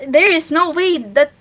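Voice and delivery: usual speaking voice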